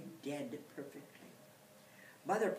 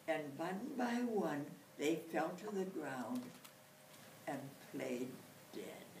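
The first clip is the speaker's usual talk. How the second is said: usual speaking voice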